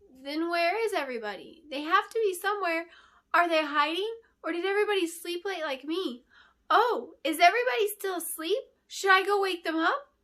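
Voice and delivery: put-on high, loud, tumbling child's voice, building; a giddy little girl bursting with ideas; excited child. Girl asking the queen where everyone is, excited and loud. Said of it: "spewed the last few thoughts out excitedly".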